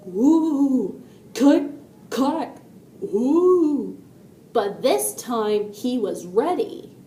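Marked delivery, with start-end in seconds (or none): 0.1-4.0 drawn-out eerie woo, sharp clicks; spooky noise. A narrator's reading. Noise returns as the mouse tries to sleep, spooky imitation. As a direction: imitate a scary noise at the window. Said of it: "he heard the noise again".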